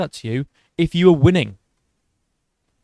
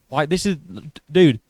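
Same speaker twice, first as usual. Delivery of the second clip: ordinary talk, nothing marked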